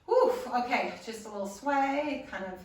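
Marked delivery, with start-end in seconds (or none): 0.1-0.9 loud whew of relief; relieved exhale. Real, not acted. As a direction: blow out a big breath. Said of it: "That felt great".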